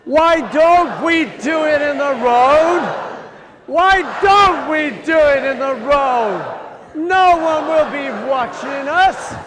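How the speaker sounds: loud like a mad Russian poet. Shown as a demonstration. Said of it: "loudly, like a mad Russian poet".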